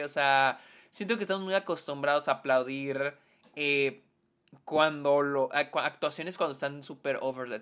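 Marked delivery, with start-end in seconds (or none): none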